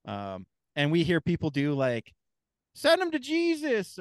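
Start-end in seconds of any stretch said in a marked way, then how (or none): 2.8-4.0 high, drawn-out mock yell; imitation hunter's shot call. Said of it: "what's the greatest shot call".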